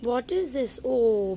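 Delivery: normal talking voice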